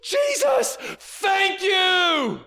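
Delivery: the right way, the leper shouting his thanks; loud thanks. Retold or imitated. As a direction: shout your thanks, overflowing. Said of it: "Luke says he did it in a loud voice".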